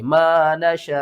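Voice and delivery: मा नशा with long शा ending in a glottal catch; slight final jerk. Shown as a demonstration. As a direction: stretch नशा, then cut it with a small jerk. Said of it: "last में थोड़ा सा झटका आना चाहिए". (मा नशा, आख़िर में हल्का झटका)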